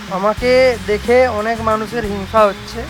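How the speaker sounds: normal talking voice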